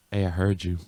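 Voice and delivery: low, soft, deadpan mom voice; imitated knowing mom. Mom confronting son next day, low knowing voice. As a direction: say it low, like a mom who knows. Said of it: "my mom was like".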